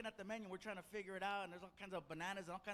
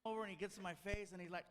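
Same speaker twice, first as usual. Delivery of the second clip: ordinary talk, nothing marked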